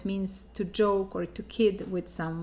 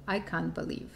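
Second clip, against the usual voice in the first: ordinary talk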